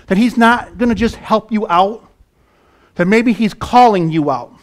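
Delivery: loud, high, driving sermon voice; fiery pulpit preaching; preaching at full force. Acted. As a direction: preach it out to the whole room.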